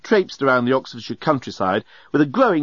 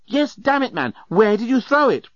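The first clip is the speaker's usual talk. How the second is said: high-pitched, exasperated demand; impatient urging; 11 semitones higher than usual. A narrator's reading. Journalist urges Leovinus to remember, impatient. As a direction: press hard for the answer.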